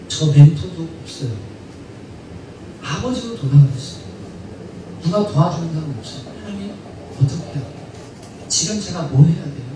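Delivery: low, hushed confiding prayer; intimate prayer. Acted. Believer with nobody to help asks God what to do, low and subdued. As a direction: confide in God quietly, pausing between pleas.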